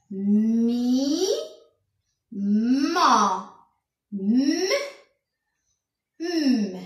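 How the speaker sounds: "Mi, ma" with long hummed m, then "m" alone; slow drill on the sound "m"; lengthened M sound. Shown as a demonstration. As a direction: stretch every m, pausing between items. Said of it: "le son M".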